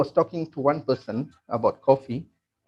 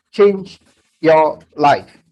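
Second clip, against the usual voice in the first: slow slogan; 9 dB louder, much slower than usual. Acted. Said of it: "slogan delivered to audience".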